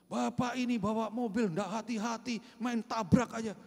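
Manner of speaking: mother berating the driver; scolding mother. Retold or imitated. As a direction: blame the driver, voice up. Mother scolds driver after crash.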